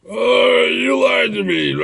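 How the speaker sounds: put-on loud, high, whiny drunk yell; drunk patron shouting. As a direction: wail it like a betrayed drunk. Drunk patron yelling at bouncer.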